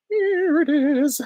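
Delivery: very high, slow falsetto; squeaky good-witch voice; Glinda. Acted. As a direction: trill it far up high, stretched out. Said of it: "from Glinda from Wicked".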